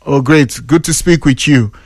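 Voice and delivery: low, smooth proper English; polished English. Pretend. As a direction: turn on courteous, polished English. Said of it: "you just watch the English wey I go speak".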